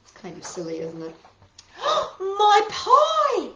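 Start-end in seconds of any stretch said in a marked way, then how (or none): 1.6-2.2 high startled gasp; sharp gasp. Acted. Amelia Bedelia remembering her pie, sharp gasp. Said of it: "exclaimed Amelia Bedelia". 2.2-3.5 high, loud cry of alarm; exclaiming. Acted. Amelia Bedelia remembering the pie in the oven, loud alarmed cry. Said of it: "exclaimed Amelia Bedelia".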